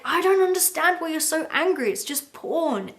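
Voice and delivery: acting out Rand's clueless shrug; dismissive quote. Rand brushing off his ex-wife's anger, lightly voiced. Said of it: "And he's like".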